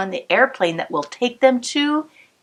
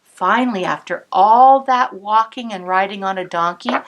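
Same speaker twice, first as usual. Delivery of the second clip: plain reading voice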